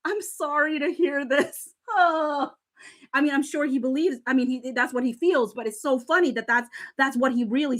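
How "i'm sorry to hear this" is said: slow, amused mock-sincere read; slow incredulous mock-sincere reading; mock earnest. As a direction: savour the line slowly as if it's absurdly pure. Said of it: "And he starts off with".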